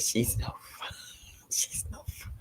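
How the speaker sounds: whispered aside, with a little laugh; confiding whisper. Real, not acted. Sister admitting "I love my sister, but", whispered. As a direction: drop to a whisper, as if confessing.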